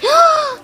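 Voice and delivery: high shocked gasp. Acted. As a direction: gasp in horror. Cake destroyed.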